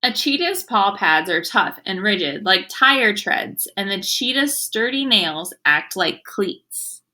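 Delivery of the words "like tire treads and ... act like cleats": normal talking voice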